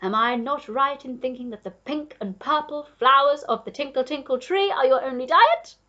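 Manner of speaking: loud, excited know-it-all duke; crying out. A narrator's reading. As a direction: show off knowledge at full voice. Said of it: "cried the Duke".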